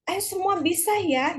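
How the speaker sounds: lifted, encouraging call to pupils; encouraging pep talk. Acted. Teacher voices cheering on the class.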